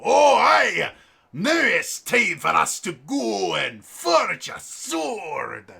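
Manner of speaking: hammed-up Glaswegian dwarf shout; Glaswegian dwarf accent. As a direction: bellow like a drunk Glaswegian dwarf. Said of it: "generic Glaswegian dwarf accent".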